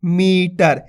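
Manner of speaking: मीटर with a long, stretched मी; slowly sounded-out मीटर, long first vowel; drawn-out long vowel. Shown as a demonstration. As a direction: hold the ee in मीटर. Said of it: "इसी प्रकार से पढ़ना".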